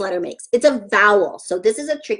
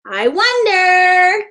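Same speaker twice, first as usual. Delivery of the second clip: drawn-out, higher singsong wondering; teacherly teasing wonder, pitched up; playful suspense; 7 semitones higher, 4 dB louder, much slower than usual. Pretend. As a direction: draw it out slowly to build suspense. Playful suspense before revealing picture.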